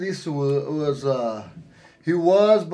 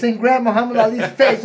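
ordinary talk, nothing marked

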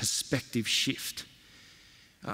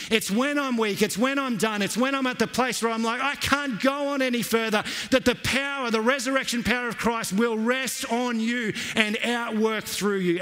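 rising preaching; 8 semitones higher than usual. Acted. Sermon climax.

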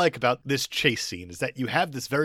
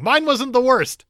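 high, loud, slow and drawn out; a pleased, defensive director; mock Baz Luhrmann; 12 semitones higher, 7 dB louder, slower than usual. Host imitating Baz Luhrmann after a bad Shakespeare scene. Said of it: "even Baz Luhrmann's going".

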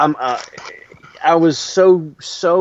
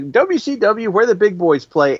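lightly imitated wrestler catchphrase, pitched up; Norman Smiley; 9 semitones higher than usual. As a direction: do a higher-voiced impression of the wrestler. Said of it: "he said in that Norman Smiley voice".

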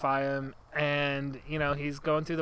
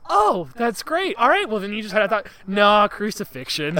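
mock Roman guard; 6 semitones higher, 9 dB louder than usual. Roman guard teasing the prisoner, loud mock cheer. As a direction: fake cheer, then a laughing no. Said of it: "He's like".

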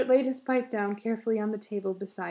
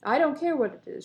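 angry retort; 5 semitones higher, 6 dB louder, faster than usual. A narrator's reading. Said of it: "she said".

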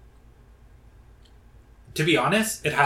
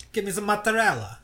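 lightly hammed-up Italian-American, pitched up; a quick mock Italian housewife bit; 7 semitones higher than usual. Joking to his cohost in a mock Italian voice.

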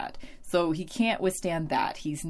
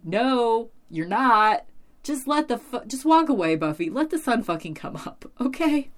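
exasperated retort; 6 semitones higher, 5 dB louder than usual. Real, not acted. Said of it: "And I'm like".

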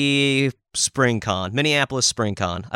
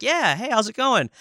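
friendly greeting; 10 semitones higher than usual. Retold or imitated. Acting out own friendly reply.

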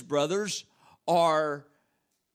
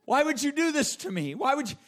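high, anguished cry to his brothers; anguished pleading; 8 semitones higher than usual. Acted. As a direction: cry out betrayed, begging for mercy. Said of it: "pleading anguish of his soul crying out".

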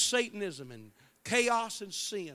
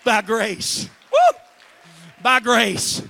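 much louder, ringing preacher's cadence; full-force preaching; 4 semitones higher, 18 dB louder, slower than usual. Acted. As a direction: hammer each "By grace" home to the crowd.

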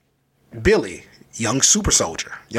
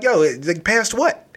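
higher-pitched, baffled question; acted bafflement; 7 semitones higher than usual. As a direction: play the confused kid, pitch climbing on the question. Acted confused reply to being passed.

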